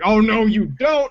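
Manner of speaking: high, slow, mock-outraged reporter; indignant reporter. Retelling a news reporter stopping a prankster, high slow mock outrage. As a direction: drag it out high like a reporter losing his cool. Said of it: "and he's like".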